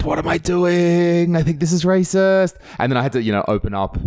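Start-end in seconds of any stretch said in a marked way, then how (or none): 0.0-2.6 higher, slowed, alarmed inner voice; hammed-up alarm, pitched up; mock panic. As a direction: fret out loud as the hand closes in. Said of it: "and I went".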